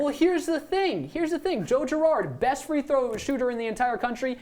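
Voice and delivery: high-pitched, breathless insistence; worked-up argument. Real, not acted. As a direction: argue your case all worked up. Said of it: "a chance to catch his breath".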